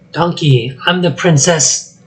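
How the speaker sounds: plain reading voice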